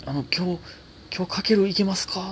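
a hint of a manager checking if he can perform tonight; manager on the phone. Manager on the phone asking about tonight, politely tentative.

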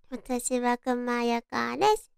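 normal talking voice

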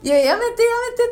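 loud, high mock protest; 周りに聞かせる大声. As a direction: imitate a student playing up to nearby passengers. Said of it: "絶対に周りを意識した音量で". (周りに聞こえるように大げさに)

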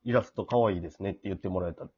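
ordinary talk, nothing marked

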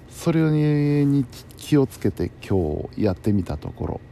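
usual speaking voice

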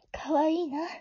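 breathless whisper to herself; whispered aside. Acted. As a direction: whisper it fondly. Aside about the boy. (ひとり言のささやき)

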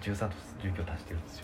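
very low, barely audible murmur; quick and hushed, as if not to be overheard; hushed aside. Real, not acted. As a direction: drop your voice to a quick, secretive undertone. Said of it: "なんで小声で言ったの？".

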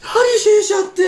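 very high, loud, drawn-out outburst; exaggerated incredulous shout. Guy retells the party's reaction to his shisha, hammed-up shout.

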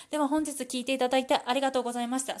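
fast, hurried sign-off; rushing to finish. Real, not acted. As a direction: hurry through the closing thanks. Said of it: "最後は駆け足でごめんなさい". (駆け足で早口に)

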